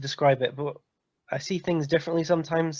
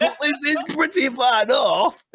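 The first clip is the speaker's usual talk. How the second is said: higher, slow Yorkshire voice; mock Yorkshire accent; 7 semitones higher, 4 dB louder, slower than usual. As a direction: protest it in broad Yorkshire. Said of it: "different Yorkshire accents".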